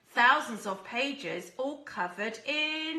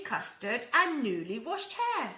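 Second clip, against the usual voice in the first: ordinary delivery, nothing marked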